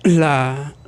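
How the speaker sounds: ordinary talk, nothing marked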